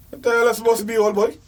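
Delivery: usual speaking voice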